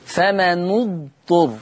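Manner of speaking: slow فمن اضطر as "fama-nu-dturr"; damma on the nun. Shown as a demonstration. Teacher shows Warsh's reading, slow "fama-nu-dturr" for فمن اضطر. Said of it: "ورش يقرأ بضم النون".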